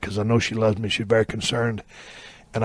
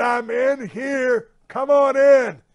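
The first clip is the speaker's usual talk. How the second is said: drawn-out call; 11 semitones higher, much slower than usual. Retold or imitated. Calls again to the firemen.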